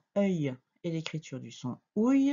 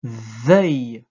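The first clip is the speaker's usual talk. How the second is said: lower, loud, drawn-out syllable; dictation; 5 semitones lower, 9 dB louder than usual. Shown as a demonstration. Teacher dictating a syllable to a pupil, low, loud and drawn out. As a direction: sound the syllable out firmly for writing. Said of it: "Je répète".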